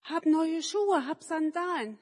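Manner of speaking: put-on eager childlike shout about new sandals; Manni calling out. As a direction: proudly call out the new shoes, slowly. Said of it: "will rufen".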